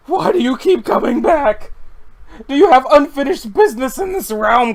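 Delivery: high, loud, desperate shouting; frantic yell. Acted. Sable screams at the ghost duck, loud and high.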